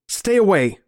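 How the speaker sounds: high, frightened warning; alarmed warning. Acted. Narrator as killer friend steps closer, alarmed warning.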